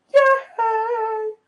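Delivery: very high put-on squeak of joy; mock squeaky yay; squeaky cheer. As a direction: cheer like a tiny cartoon voice.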